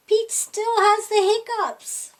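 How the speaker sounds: high, loud, fed-up narration; exasperated. A narrator's reading. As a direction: throw up your hands in dismay.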